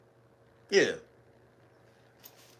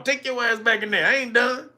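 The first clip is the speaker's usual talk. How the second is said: usual speaking voice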